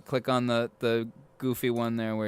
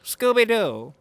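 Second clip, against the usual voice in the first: cartoon voice; 7 semitones higher, 4 dB louder, slower than usual. Voice bit continues.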